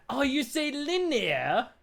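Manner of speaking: "linear" said as lin-ee-uh, dropped R, posh mock; mock British accent. Streamer mocking the dictionary's British pronunciation in a mock British accent. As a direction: tease in a light fake British accent. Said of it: "Oh, you're British?".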